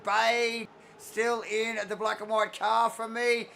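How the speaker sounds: loud, high excited race call; play-by-play. Acted. Live play-by-play.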